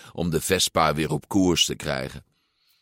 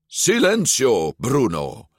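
higher, firm, drawn-out command; more confident; 5 semitones higher, slower than usual. A narrator's reading. Luca silencing his inner voice, confidently. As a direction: say it with conviction. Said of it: "met meer zelfvertrouwen".

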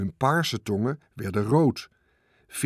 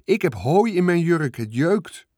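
raised high, louder call; 5 semitones higher, 4 dB louder than usual. A narrator's reading. Girl on the swing calling about itchy hay, raised voice. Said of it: "riep Veerle".

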